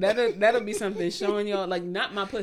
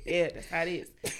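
ordinary talk

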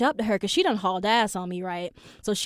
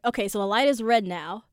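usual speaking voice

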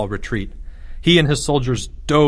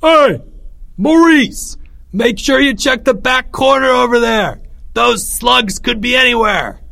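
high, loud calling out; yelling; 12 semitones higher, 5 dB louder than usual. A narrator's reading. Greg calls to Maurice from the lookout, yelling. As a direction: yell it across the battlefield. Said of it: "yelled above them loud and clear".

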